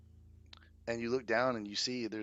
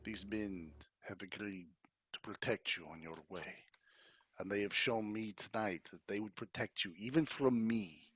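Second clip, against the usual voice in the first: low, soft, measured nobleman; courtly count, confessing; 3 semitones lower, 8 dB quieter than usual. Acted. Count confessing his love to Irina, low courtly tenderness. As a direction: confide gravely, with pauses between phrases.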